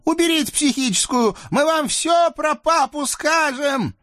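very high squeaky devil voice, pleading; a scrawny devil begging off; whiny little devil. Acted. Наум умоляет. (писклявый чёрт)

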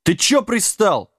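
high, drawn-out indignant complaint. A narrator's reading. Giant tells Wilkins off, indignant. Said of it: "возмутился Дибелмен".

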